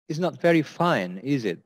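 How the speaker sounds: "is it" slightly falling at the end; falling tag intonation. Shown as a demonstration. Teacher demonstrates weather small talk, falling tag. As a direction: let "is it" drop like a statement. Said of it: "intonation is slightly going down at the end".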